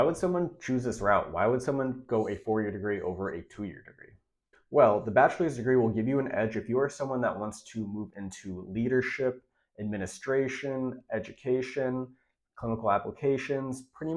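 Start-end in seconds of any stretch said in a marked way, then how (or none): none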